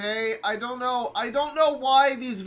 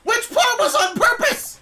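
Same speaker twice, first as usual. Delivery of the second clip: much higher, loud incredulous demand; indignant shout; 12 semitones higher, 6 dB louder than usual. Real, not acted. Viewer answering the video's apology, loud indignant shouts. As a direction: throw the question back, outraged.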